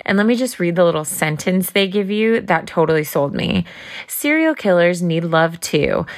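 upbeat sponsor read. Acted. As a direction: pitch the product brightly.

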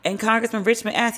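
normal talking voice

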